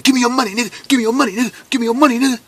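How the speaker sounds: high, tense demand for money; robber's demand. Retold or imitated. Robber with a gun demanding money, high and tense. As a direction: bark it like a stickup kid.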